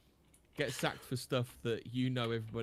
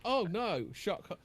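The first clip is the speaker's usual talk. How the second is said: sarcastic; 7 semitones higher than usual. Real, not acted. Sarcastic mock outrage.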